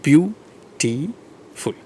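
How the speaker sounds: "Beautiful" very slow, three long separated syllables. Shown as a demonstration. Teacher showing there are three syllables, "Beautiful" drawn out syllable by syllable. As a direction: stretch "Beautiful" out, pausing between each syllable. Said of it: "there are three syllables".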